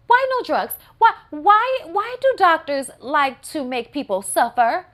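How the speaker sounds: high, loud disbelieving wail; mock-anguished shriek; exasperated outcry. Real, not acted. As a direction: cry out at the doctors. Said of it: "rising exasperated repeats".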